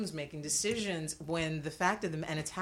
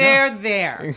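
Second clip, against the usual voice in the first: emphatic agreement; 5 semitones higher, 15 dB louder than usual. Real, not acted. Emphatic repeat.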